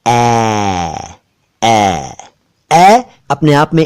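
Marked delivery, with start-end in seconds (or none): 0.1-2.1 "ए" stretched as wide "aeee"; drawn-out open AI. Shown as a demonstration. Teacher saying which sound is meant, long open "ए". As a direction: sustain "ए" slowly with the jaw dropped, "ae". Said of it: "मैं कह रहा हूं ए".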